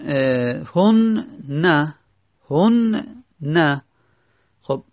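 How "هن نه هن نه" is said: هن نه said very slowly, with the doubled nun held; drawn out slowly. Shown as a demonstration. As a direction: stretch هن نه syllable by syllable. (هن نه کشیده و شمرده)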